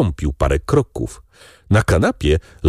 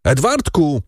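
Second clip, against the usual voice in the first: high-pitched calling, held back in volume; narrated shout with raised pitch; crying out; 6 semitones higher than usual. A narrator's reading. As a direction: call the boy's name in alarm. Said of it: "krzycząc".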